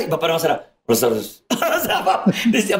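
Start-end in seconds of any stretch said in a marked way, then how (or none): 0.9-1.4 curt father greeting. Retold or imitated. Stern father voiced.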